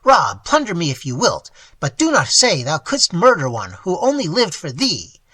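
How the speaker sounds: put-on old man begging his ward to take the gold; pleading exclamation. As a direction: beg him with sudden energy. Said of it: "with sudden energy, he exclaimed".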